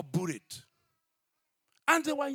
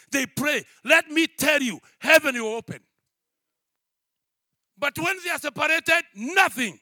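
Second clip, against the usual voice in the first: loud, shouted sermon with call-outs; full-force preaching; 7 semitones higher, 13 dB louder than usual. Acted. Pastor to congregation on marital oneness, shouted preaching. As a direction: drive it home to the church, shouting.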